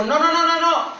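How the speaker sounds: high, loud string of refusals; crowd's refusal. Acted. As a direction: cry out like a crowd rejecting an offer. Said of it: "el pueblo de Israel le dijo".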